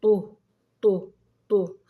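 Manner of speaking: طو as a loud, separate "tu"; letter with damma. Shown as a demonstration. Teacher has pupils listen to the damma sound, loud separate طو. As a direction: punch out each طو with a rounded u. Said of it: "اسمعوا صوت الحرف".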